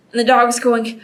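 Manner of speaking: plain reading voice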